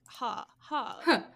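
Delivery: spoken ha's, read out word by word; transcribed laughter. Acted. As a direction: read laughter out as separate words. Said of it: "where I was just laughing".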